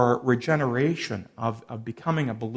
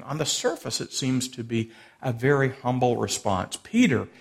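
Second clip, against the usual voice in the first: ordinary talk, nothing marked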